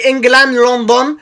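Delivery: normal talking voice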